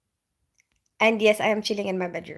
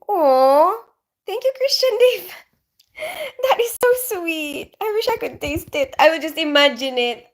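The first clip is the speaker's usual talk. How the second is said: touched, near tears; 8 semitones higher, 4 dB louder than usual. Real, not acted. Viewer cooks a dish for her.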